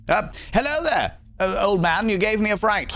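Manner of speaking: mock startled greeting. Streamer greets an old man in the game in a high startled voice. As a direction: play it like a flustered lady caught off guard.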